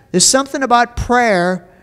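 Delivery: ordinary delivery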